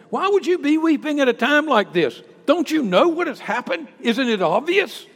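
high, loud disbelief; why weep, can't you see it; incredulous angels. Acted. Angels incredulous, voiced loudly.

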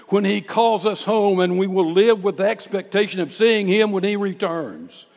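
loud, high preacher's proclamation; full-force preaching. Real, not acted.

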